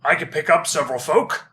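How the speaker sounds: loud, booming lumbering giant; dopey cartoon bellow. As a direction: bellow it like a big friendly giant. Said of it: "the giant says".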